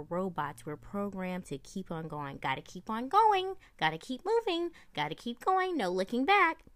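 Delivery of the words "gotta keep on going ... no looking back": high, loud mechanical robot. Acted. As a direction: drone it like a programmed robot. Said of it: "And that was my robot voice".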